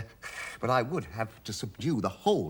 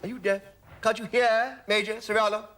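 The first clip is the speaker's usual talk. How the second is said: raised, higher-pitched rebuke; irritated scolding; 7 semitones higher than usual. Acted. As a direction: tell off a servant who didn't come. Master scolds slow servant.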